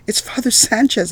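barely containing excitement; excited. A narrator's reading. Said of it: "barely able to contain my excitement".

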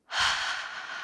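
long soft exhale; deep sigh. Acted. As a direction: breathe out slowly. Breath exercise.

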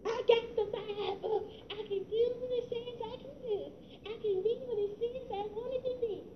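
a hint of a syrupy prosperity preacher, mocked; mock self-help preacher. Preacher mocks false teaching in a hushed mock voice. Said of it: "You wanna listen to that kind of garbage?".

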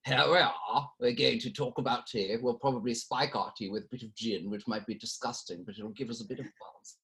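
put-on English gent voice, fading at the end; English accent. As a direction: chat about tea in an English accent. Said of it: "an Englishman who's like".

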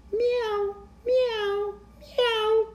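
high, thin, soft meows; cat meow. Acted. Dog that has caught the cat's sound, high soft meows. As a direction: mew three times like a cat. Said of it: "said the dog".

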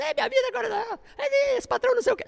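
high whiny grumbling, half laughing; complaining. Retold or imitated. Jobless father coming home whining to his family, high whine. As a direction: moan about the boss.

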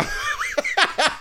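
a sudden high burst of laughter; 1 high cackling laugh. Real, not acted. Laughing at Chance freezing on the steps, a high cackle. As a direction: crack up at the frozen feet.

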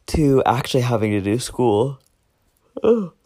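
talking through a yawn; words stretched by a yawn. Real, not acted. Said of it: "Sorry if I'm making you yawn too".

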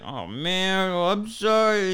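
high, drawn-out whiny apology; mock whine climbing high; imitated upset kid. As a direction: mimic a sulking child, stretching the words. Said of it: "He gets all mad".

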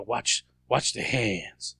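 low, drawn-out mock lawman voice; Southern lawman catchphrase. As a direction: imitate a lawman, dragging the words out low. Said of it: "He's always said".

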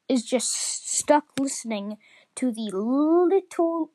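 usual speaking voice

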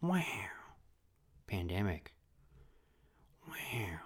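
hushed, whispered wow in disbelief; whispering alone in the shower after pandemic news; stunned whisper. Retold or imitated. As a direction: breathe it out in quiet shock. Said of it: "was just, like, silent".

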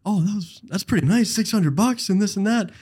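high-pitched mock impressed coworker. Retelling coworker's impressed reply.

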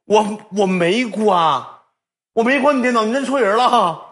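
mock squeaky denial to the attendant; mock high pleading denial. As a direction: whine your innocence in a squeaky voice. Falsetto mocking denial.

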